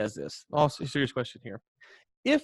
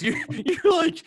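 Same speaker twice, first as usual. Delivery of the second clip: high, slow words through giggles; barely getting it out while laughing; laughing hard, much higher; 4 dB louder, slower than usual. Real, not acted. Cracking up at the joke.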